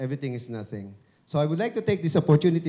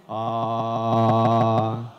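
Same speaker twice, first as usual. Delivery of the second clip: a long, loud open "Ah" to feel the voice buzz; loud held resonant ah; 4 semitones lower, 6 dB louder than usual. Shown as a demonstration. Speaker coaching the audience to feel timbre, loud sustained ah. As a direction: sustain a full "Ah" and let the chest ring. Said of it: "can you feel your voice".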